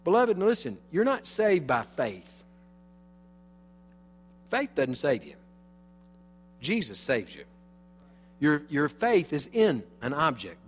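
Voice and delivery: rising pulpit fervor; fervent preaching. Acted. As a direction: drive it home to the congregation. Sermon builds to fervor.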